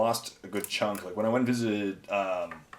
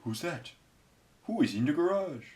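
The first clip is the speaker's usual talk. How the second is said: higher, swooping mimicked voice; Rubik; 6 semitones higher than usual. Hearing a voice from outside, mimicked high questioning. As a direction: put on a questioning pet-like voice. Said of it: "That's my impression of Rubik".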